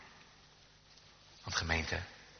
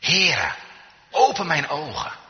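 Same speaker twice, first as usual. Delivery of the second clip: raised, high imploring cry; calling out to the Lord; 7 semitones higher, 6 dB louder, slower than usual. Acted. Blind man begging the Son of David for sight, calling out. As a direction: cry out as a plea for mercy. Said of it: "Dan mag je hem aanroepen".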